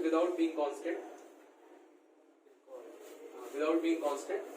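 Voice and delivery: very slow, word-by-word dictation; drawn-out phrases with writing pauses. Real, not acted. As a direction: read it out for students writing it down. Dictates a definition for students to write.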